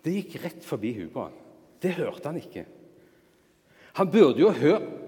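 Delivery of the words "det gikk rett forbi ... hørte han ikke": usual speaking voice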